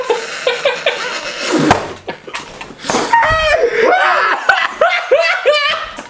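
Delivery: high, wild burst of laughter; shrieking laugh. Real, not acted. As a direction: howl with laughter. Huge laugh after joke.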